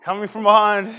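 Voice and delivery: loud horse race announcer call; race caller. Pretend. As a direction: call it like the home stretch. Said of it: "Wish I was like a horse race announcer".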